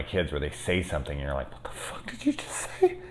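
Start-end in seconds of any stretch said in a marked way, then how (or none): 1.5-3.0 high, breathless whispered disbelief; shocked whisper. Retold or imitated. Parent hearing a child's insult, shocked whisper.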